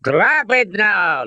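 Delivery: high, slow, forceful command; demanding. A narrator's reading. Colonel to armed man, demanding. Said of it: "the colonel demanded".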